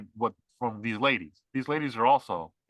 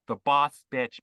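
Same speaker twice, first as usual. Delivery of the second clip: usual speaking voice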